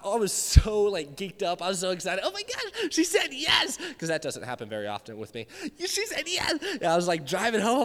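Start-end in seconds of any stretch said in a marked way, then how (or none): 2.2-3.8 put-on high, gushing teen glee; excited squeal. Retells excitement after asking girl out. 5.8-6.6 put-on high, gushing teen glee; excited squeal. Repeats excited memory.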